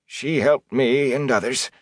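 understated pleading. A narrator's reading. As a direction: plead reluctantly, drawing the words out. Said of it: "he went on in a pleading tone".

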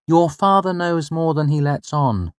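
knowing and a little amused; mother's voice. Acted. The mother explaining the father to her son, knowingly. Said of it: "my mother said".

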